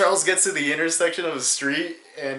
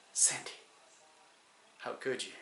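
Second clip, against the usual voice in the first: very soft, wounded reproach; hushed mock betrayal; 2 semitones lower, 17 dB quieter than usual. Driver vindicated before friend's mom, hushed mock betrayal. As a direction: say it barely aloud, feigning heartbreak. Feigned betrayal after burnout heard.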